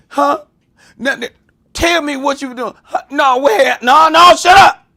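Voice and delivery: hammed-up furious mother, building to a yell; imitating angry mom. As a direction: scold like mom mid-whooping. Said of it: "the while you was getting the whooping talk".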